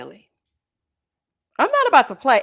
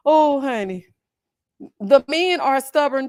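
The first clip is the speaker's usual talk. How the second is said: ordinary delivery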